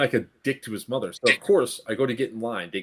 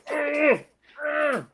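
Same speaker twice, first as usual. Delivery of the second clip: high, effortful mock grunts; straining grunts; faster than usual. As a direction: imitate someone straining to pull something out. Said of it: "she was like this. She was like, going".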